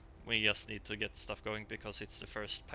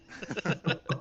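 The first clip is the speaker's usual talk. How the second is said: laugh; 7 semitones higher than usual. Real, not acted. Laughing at the Cockney joke.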